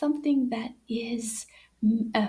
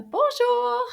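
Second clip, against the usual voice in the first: a lightly voiced higher puppet greeting; playful higher puppet hello; Anana's voice; 11 semitones higher, 4 dB louder than usual. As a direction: chirp it like the little puppet friend. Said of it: "Et voici Anana qui dit".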